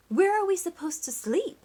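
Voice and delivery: worried frogs asking; fretting frogs. A narrator's reading. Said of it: "asked the frogs".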